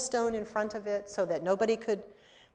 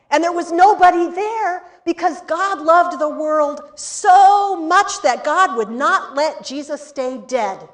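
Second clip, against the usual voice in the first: louder, higher, slowing with joy; joyful proclamation; 6 semitones higher, 9 dB louder than usual. Retold or imitated. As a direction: proclaim it with rising wonder. Joyful climax of resurrection.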